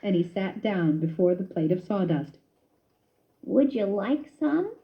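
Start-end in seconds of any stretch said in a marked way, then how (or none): 3.4-4.7 slow, weak little question; a guilty mouse hoping for company; faint, drooping voice; weakly asking. A narrator's reading. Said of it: "he asked weakly".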